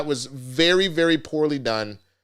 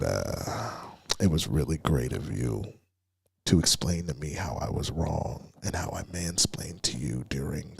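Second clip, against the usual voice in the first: mock creepy voicemail; 7 semitones lower, 3 dB quieter than usual. Mocking a creepy guy leaving a late-night voice message, low mock voice.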